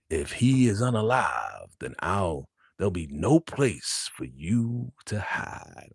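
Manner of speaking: slow, deliberate warning; acted menacing threat. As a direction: imitate a father's threat, drawing out the words. Acted threat from angry father.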